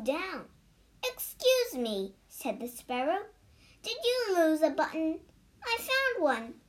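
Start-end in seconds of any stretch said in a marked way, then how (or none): 1.0-2.1 small bird voice, pitched high; a chirpy sparrow asking politely; high little sparrow. A narrator's reading. Sparrow speaks to Toad. 3.8-6.6 sparrow voice. A narrator's reading. Sparrow offers a button.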